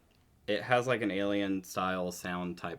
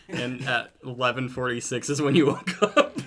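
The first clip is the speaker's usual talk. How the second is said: laughing; 4 semitones higher, 8 dB louder than usual. Real, not acted. Teasing friend about oversleeping.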